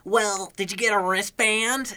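high nasal know-it-all nerd. Retold or imitated. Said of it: "with the most highest nasally voice".

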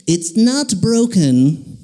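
drawn-out, deliberating medical verdict; doctor. Retold or imitated. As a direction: hum and ponder like a doctor studying an x-ray. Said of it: "and he goes".

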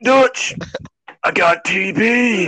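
high, strained mock cry of despair; sick character impression. Friend acts out telling Dutch of TB, strained. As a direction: draw it out like a sick outlaw confessing.

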